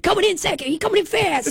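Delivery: lightly voiced race announcer. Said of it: "I do an announcer voice".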